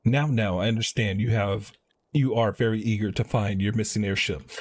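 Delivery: usual speaking voice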